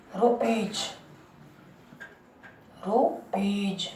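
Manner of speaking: soft, slow "रुपीज" ending in j; final J sound. Shown as a demonstration. Teacher sounding out rupees, final J sound. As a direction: draw out "रुपीज" as ru-peej. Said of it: "जा की sound आ रही है".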